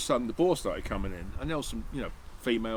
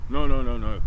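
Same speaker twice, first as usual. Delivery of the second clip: loud mock-insistent no's; imitating a guide's emphatic refusal, loud; mimicking the guide's refusal; 10 dB louder than usual. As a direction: bark the no's like the stubborn guide.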